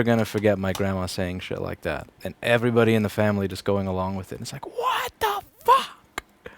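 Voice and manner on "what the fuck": high, very slow drawn-out disbelief; mock disbelief. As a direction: stretch it out in shock. Mock reaction to family.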